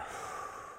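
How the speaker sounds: long unvoiced exhale, calming; deep breath out. Real, not acted. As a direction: let a slow, deep breath go. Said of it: "take a deep breath".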